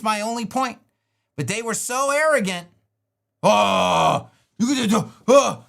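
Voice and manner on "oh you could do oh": loud dumb caveman grunting. Retold or imitated. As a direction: boom it out like a caveman. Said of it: "I gotta give 'em the caveman voice".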